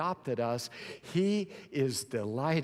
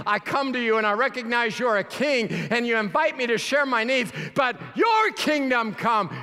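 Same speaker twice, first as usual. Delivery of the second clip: louder, higher, building preaching; rising sermon fervour; 7 semitones higher, 7 dB louder than usual. Acted. Preacher to congregation, building to a loud cry. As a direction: swell toward the cry of kingdom come.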